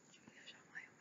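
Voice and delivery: breathy whisper of the name. Shown as a demonstration. Fan whispering her favourite character's name, whisper. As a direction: whisper it dreamily to yourself.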